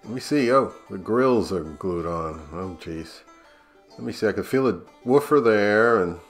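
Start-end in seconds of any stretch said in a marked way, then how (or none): none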